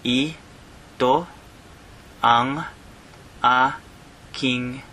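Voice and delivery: "Ito", "ang", "aking" with pauses between; word by word. Shown as a demonstration. Teacher breaking the phrase down word by word. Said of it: "let me break that down".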